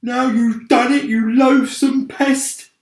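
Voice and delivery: usual speaking voice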